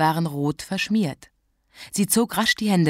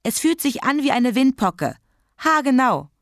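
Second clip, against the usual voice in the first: put-on high, insistent whiny child; whining; 8 semitones higher than usual. A narrator's reading. Child insisting to Mama it is chickenpox, whining. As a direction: insist plaintively, pitch well up. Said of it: "jammerte Kathi".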